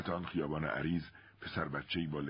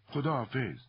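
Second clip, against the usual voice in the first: high-pitched shouted goodbye; called-out shout; 7 semitones higher, 4 dB louder than usual. A narrator's reading. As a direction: call goodbye across to someone leaving. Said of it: "متی داد زد". (با داد و صدای زیر)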